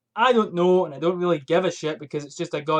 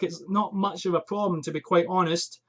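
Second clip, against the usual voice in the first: ordinary talk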